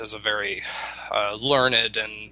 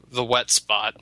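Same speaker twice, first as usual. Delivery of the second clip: normal talking voice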